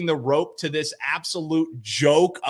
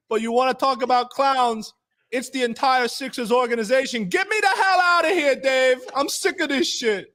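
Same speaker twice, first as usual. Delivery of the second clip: mock rant; 8 semitones higher than usual.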